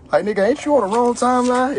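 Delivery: high-pitched, incredulous question; incredulous challenge. Acted. Comic calling out someone on the wrong timeline, incredulous. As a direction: challenge them in high mock disbelief.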